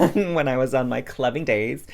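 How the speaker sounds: loud burst through laughter; amused. Real, not acted. Vlogger recalling her clubbing days, amused and laughing. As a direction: laugh your way through it, loudly.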